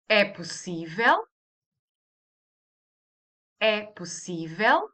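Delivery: "É possível" as a question, pitch climbing on "possível". Shown as a demonstration. Teacher modelling a Portuguese question, rising tune on "possível". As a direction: swing the end of "possível" upward, asking. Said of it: "Raising the intonation".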